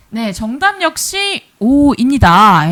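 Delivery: slow, drawn-out answer reveal; dramatic reveal. Acted.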